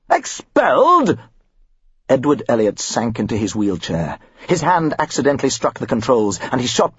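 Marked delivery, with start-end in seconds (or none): 0.1-1.2 outraged cry. A narrator's reading. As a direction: explode with the word. Father repeats in shock.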